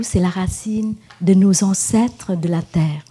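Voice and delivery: usual speaking voice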